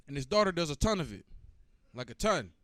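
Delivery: ordinary talk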